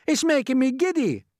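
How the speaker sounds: very high, loud complaint; calling out. A narrator's reading. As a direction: call down in high-pitched distress. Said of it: "Miss Spider called out".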